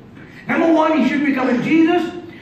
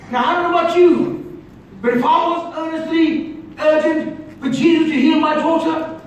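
ordinary talk

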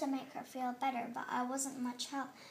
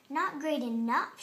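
usual speaking voice

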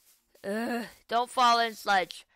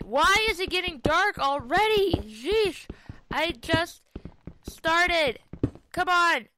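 loud complaint; 6 semitones higher, 6 dB louder, slower than usual. Real, not acted. Complaining that night is falling.

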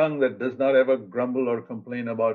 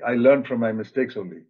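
usual speaking voice

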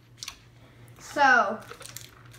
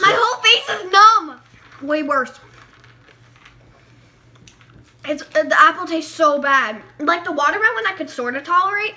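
loud; 2 semitones higher, 10 dB louder than usual. Real, not acted. Reacting to the sour candy.

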